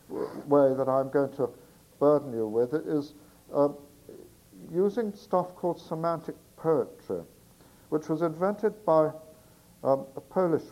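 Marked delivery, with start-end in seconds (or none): none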